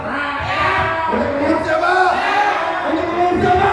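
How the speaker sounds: shouting at the officials. Real, not acted. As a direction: raise it to a yell. Protest speech demanding crowd answer.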